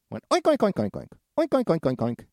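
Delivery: high, loud piggy oinks; oinking. A narrator's reading. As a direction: squeal the oinks as you flee. Piggies flee oinking.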